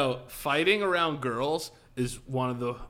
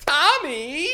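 put-on high, loud girlfriend voice; girlfriend at party; 12 semitones higher, 9 dB louder than usual. Girl at the party calling her boyfriend, high mock-girlish voice. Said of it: "And then she goes".

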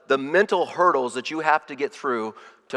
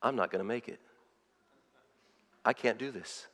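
quiet inner doubt; 3 semitones lower, 12 dB quieter than usual. Retold or imitated.

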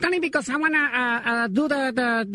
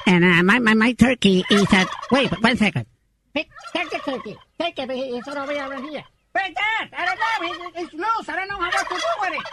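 put-on old father voice; 3 semitones lower than usual. Prank caller as the father complaining about his son, low gruff voice. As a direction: growl it like a grumpy old dad. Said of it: "my father here is with me".

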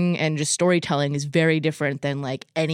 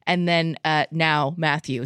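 normal talking voice

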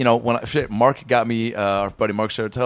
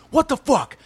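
high, indignant mock yell; retold angry outburst; 14 semitones higher than usual. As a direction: imitate an irate coworker blowing up. Retold angry boss at restaurant.

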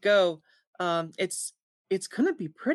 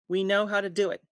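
normal talking voice

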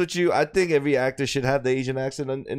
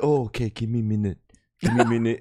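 put-on Korean accent; 3 semitones lower than usual. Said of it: "try this ac-, Korean accent thing".

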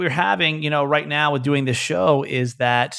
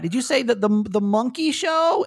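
put-on curious little kid; 9 semitones higher than usual. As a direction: play a toddler asking innocently. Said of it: "the kid was like".

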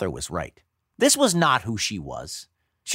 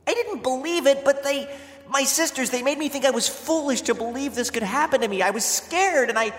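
high, pleading heroine voice; distraught young wife; 14 semitones higher than usual. A narrator's reading. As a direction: explain desperately to a hurt husband, pitched high.

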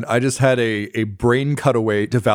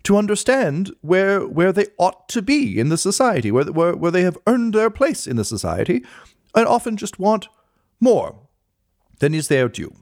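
pompous, higher, self-important lecturing; puffed-up clerk; 8 semitones higher than usual. Acted. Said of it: "puffs himself up a little bit".

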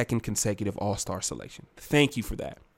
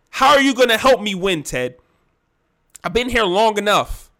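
raised, loud confrontational voice; heated demand; 6 semitones higher, 8 dB louder than usual. Acted. Fed-up player confronts team owner, heated and loud. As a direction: challenge the boss hard, acted frustration. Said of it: "It's getting a little bit heated".

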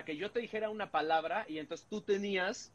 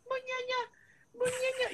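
grito de susto muy agudo; repítelo agudísimo; imitando el susto; 3 dB quieter than usual. Retold or imitated. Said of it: "Y el güey ya es como". (imitación aguda del susto)

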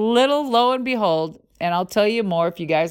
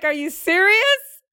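disbelieving; 9 semitones higher than usual. Retold or imitated. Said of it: "And I'm like".

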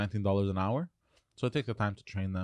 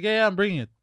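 higher, louder careless brush-off; mock lazy waiter; 10 semitones higher, 6 dB louder, faster than usual. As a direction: wave off the customer's request. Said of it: "I'm like".

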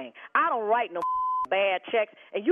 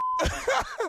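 high laugh; 12 semitones higher than usual. Real, not acted. Laughing at reveal.

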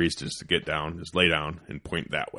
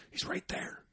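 whisper; 14 dB quieter than usual. Retold or imitated. Hunter whispering near turkeys.

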